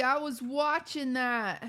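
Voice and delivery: slow, drawn-out whiny complaint; mock whiny viewer. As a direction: drag it out like a sulking fan. Mock whining viewer quote again.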